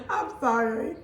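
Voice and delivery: laughing through an apology; real laughter. Real, not acted. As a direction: giggle while apologizing. Laughing at husband.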